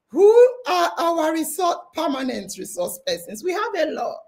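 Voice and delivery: ordinary talk, nothing marked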